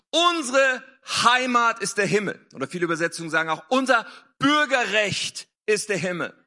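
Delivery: ordinary delivery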